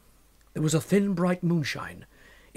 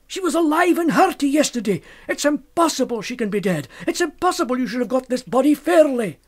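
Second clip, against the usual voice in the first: much higher and loud, pleading; frantic insistence; 8 semitones higher, 5 dB louder than usual. Acted. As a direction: insist wildly, voice climbing. Fettes accuses the body-snatchers.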